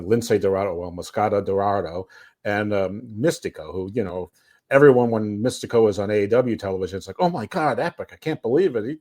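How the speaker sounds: normal talking voice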